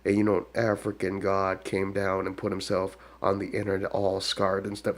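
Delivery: normal talking voice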